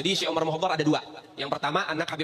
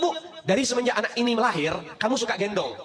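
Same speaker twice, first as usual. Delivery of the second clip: ordinary talk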